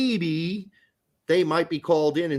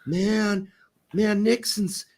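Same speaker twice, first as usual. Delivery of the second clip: higher, drawn-out hippie voice; 4 semitones higher, much slower than usual. Acted. Mimicking hippie-era talk, spaced-out hippie. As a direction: drag out each "man" like a hippie. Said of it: "people started talking like".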